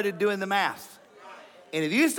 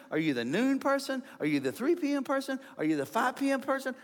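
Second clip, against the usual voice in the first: ordinary talk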